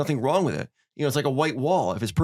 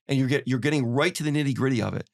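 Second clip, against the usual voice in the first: ordinary delivery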